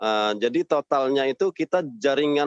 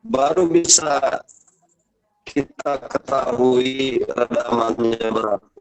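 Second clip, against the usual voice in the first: glitching audio; slower than usual. Real, not acted. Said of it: "Suaranya putus-putus".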